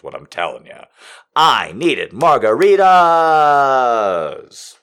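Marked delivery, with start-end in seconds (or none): 1.3-4.8 loud, very slow, drawn-out yell; hammed-up desperate cry; shout. Real, not acted. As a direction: belt it out dramatically. Comic shouted exclamation.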